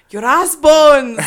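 very loud, high Scottish roar; slow, hammed-up Scottish shout; intense Scottish accent. Shown as a demonstration. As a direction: bellow it like a Scottish warrior. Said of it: "Scottish is more intense".

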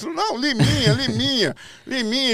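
high imitated chorus of jurors; jurors calling out. Jurors all supply the kid's nickname to the host, high amused calling. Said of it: "a Sônia Lima e o Silvio Lucas".

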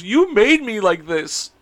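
lightly mimicked tearful daughter; imitated crying child. Daughter blaming dad through tears, imitated crying. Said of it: "she starts crying".

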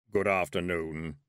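low, slow voice of Death; grim reaper voice. A narrator's reading. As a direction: speak slowly like a solemn reaper. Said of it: "said Death".

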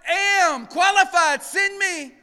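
loud, high, slow eager cry; answering the Lord's call, a loud high eager shout. Acted. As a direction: shout it out, volunteering with everything. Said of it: "And I said".

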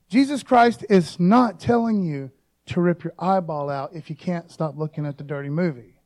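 normal talking voice

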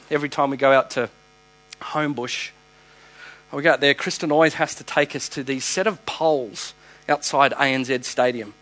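normal talking voice

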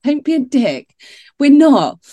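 high, loud denial; mock-indignant no; playful protest. Real, not acted.

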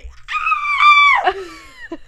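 high, loud shriek; piercing retold scream of horror; reenacted scream. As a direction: let out a mock horrified scream. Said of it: "and I scream".

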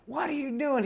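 high, outraged screech; imitated woman screaming. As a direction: shriek it at him in alarm, acted out. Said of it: "She started screaming at him".

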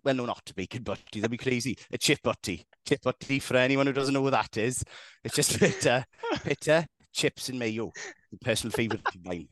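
put-on Valleys Welsh; amateur Welsh impression; Welsh accent. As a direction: keep up the mock Welsh accent. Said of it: "don't speak in Welsh".

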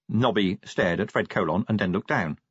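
ordinary delivery, nothing marked

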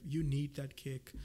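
normal talking voice

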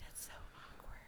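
barely-there whisper; hushed awkward aside; embarrassed whisper. Retold or imitated. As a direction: whisper it to yourself, mortified. Retold embarrassed thought after winking.

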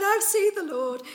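high, loud breathless announcement; thrilled shout of good news; excited Mary. Retold or imitated. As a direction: burst in with joyful news.

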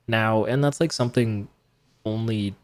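usual speaking voice

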